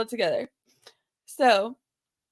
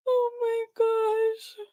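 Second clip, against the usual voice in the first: slow, high, strained through laughter; words squeezed out while cracking up; laughing; 8 semitones higher, 4 dB quieter, much slower than usual. Real, not acted. Laughing at fart chat.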